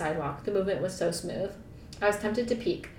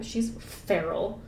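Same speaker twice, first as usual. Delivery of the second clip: usual speaking voice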